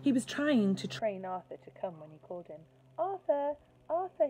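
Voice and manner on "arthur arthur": high, loud coaxing call. A narrator's reading. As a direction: call the worm sweetly, coaxing it over. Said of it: "he coaxed".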